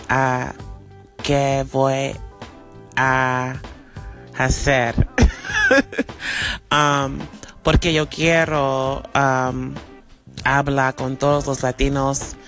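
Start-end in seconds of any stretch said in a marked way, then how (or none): 5.0-6.7 embarrassed laugh. Real, not acted. Embarrassed at imperfect Spanish.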